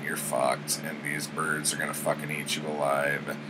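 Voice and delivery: low, soft mock-barbarian threat; lightly voiced hero gloating over a doomed enemy; understated taunt. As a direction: drop low and quiet, matter-of-fact menace. Conan taunts crucified enemy.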